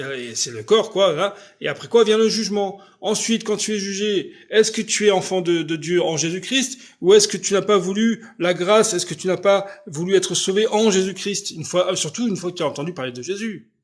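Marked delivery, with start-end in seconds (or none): none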